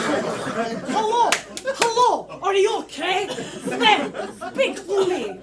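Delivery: high, loud worried calls; loud, high calls to a silent Sven; calling out. Acted. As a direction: call out to find him.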